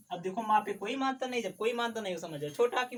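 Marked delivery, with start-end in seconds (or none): none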